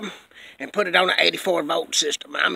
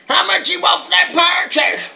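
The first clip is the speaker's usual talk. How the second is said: eager buyer; 9 semitones higher, 5 dB louder than usual. Retold or imitated. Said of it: "They was wanting to say".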